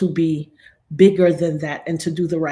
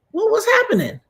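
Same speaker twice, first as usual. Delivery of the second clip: high startled question; 3 dB louder than usual. Real, not acted. Screen glitching mid-stream, startled high cry. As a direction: yelp it in sudden alarm.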